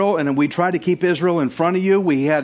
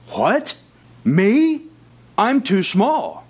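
mock disbelief; 5 semitones higher, much slower than usual. Saul voiced in retelling.

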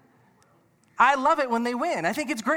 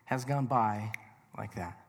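wistful slow musing; 7 semitones lower, 8 dB quieter, much slower than usual. Retold or imitated. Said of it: "I look and go".

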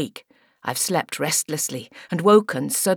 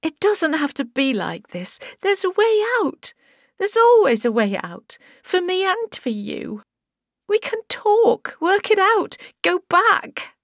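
high, tearful bargaining victim; crying plea; 12 semitones higher than usual. Acted. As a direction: desperately talk him down. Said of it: "Crying now".

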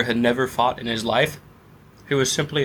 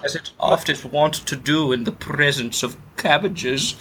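higher, slow put-on genteel accent; posh mock accent; 4 semitones higher, slower than usual. Thief eyeing the cabbages, in a slow posh put-on accent. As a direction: drawl it like a fussy aristocrat. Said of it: "my accent has changed".

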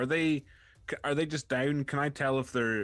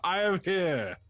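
grandly declaring hero; pompous vain hero; 7 semitones higher, slower than usual. Acted. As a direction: heroic proclamation, a touch higher. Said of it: "glow with a radiant light and say".

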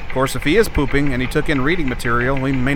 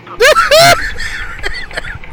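loud laugh; 4 dB louder than usual. Real, not acted. Host bursts out laughing.

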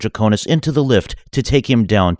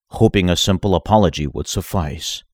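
ordinary delivery

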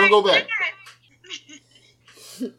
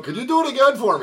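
high-pitched, eager request with a laugh; mock American tourist; 9 semitones higher than usual. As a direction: play the delighted tourist asking for a replay. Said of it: "A typical American would take out her phone".

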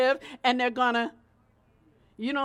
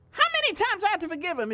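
fast, louder fed-up repeat; exasperated; 4 semitones higher, 5 dB louder, much faster than usual. Retold or imitated. Preacher echoing Peter's complaint, fed up and fast. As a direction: rattle it off like you've had enough. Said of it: "'cause I'm going on eight right now".

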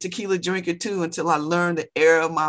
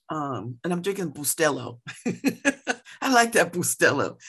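ordinary talk

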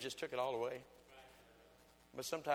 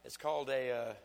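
preaching; 6 semitones higher, 9 dB louder than usual. Acted. Preaching at full force.

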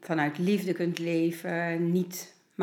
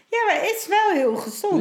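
excited, high-pitched; 13 semitones higher, 5 dB louder than usual. Real, not acted. Laughing, emphatic agreement.

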